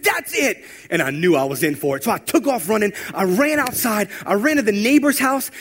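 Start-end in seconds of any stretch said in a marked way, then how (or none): 0.0-0.6 sharp high mock yell of a fed-up leader; angry outburst. Youth pastor losing patience with a pestering kid, high sharp yell. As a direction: snap it out like the last straw. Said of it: "Lance slammed his hands down on the table".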